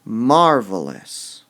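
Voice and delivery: Marvelous slow and loud with MAR hit hard; stress on MAR. Shown as a demonstration. Teacher stresses the mar part of Marvelous for students. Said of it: "We emphasize the mar part".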